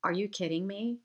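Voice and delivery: normal talking voice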